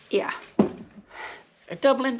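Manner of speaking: ordinary delivery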